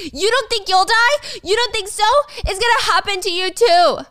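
pitched high and loud, furious taunting. Acted. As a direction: spit it out high while yanking her hair. Gia attacks Stacy, pulling hair.

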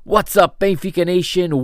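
high, loud hype-man greeting; podcast host intro. Acted. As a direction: pump up the listeners.

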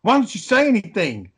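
a light impression of a jeering bully; mocking bully. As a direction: goad him to answer. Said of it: "He's like".

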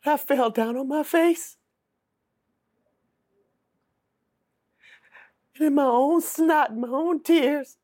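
high, choked voice near tears; tearful prayer. Real, not acted. Woman recalling her prayer on the prison floor, tearful and high. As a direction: pray through the tears, broken.

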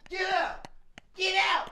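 high playful yell to shoo someone out; yelling get out. Real, not acted. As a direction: shout them out of the room, laughing. Shooing someone out, high shout.

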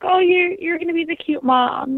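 high, light teasing voice of a friend. Retold or imitated. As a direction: tease her like a playful friend. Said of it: "my friends would joke".

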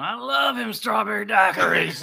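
slurred, loud laughing impression; drunk father-in-law. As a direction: imitate a drunk, slow and loud. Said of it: "He just kept going".

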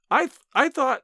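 high, stammering astonishment; amazed gasp. A narrator's reading. Niece stunned by aunt's offer, gasping and stammering. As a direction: stumble over it, too amazed to finish. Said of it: "I gasped".

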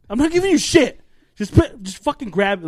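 sharp, loud parental scolding; imitating yelling dad. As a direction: bark at the kid like an irritated father. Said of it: "all the times my dad would go".

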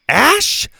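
high, loud startled shout. A narrator's reading. Bo recognizes the masked rival, shouting. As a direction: shout the name in sharp recognition. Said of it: "Bo shouted".

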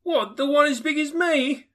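high, loud, astonished; boy amazed at the question; street boy. A narrator's reading. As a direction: answer as a cheeky boy. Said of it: "returned the boy".

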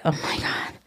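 breathless whispered disbelief; stunned whisper. Real, not acted. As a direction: whisper it in shock. Mom's stunned reaction.

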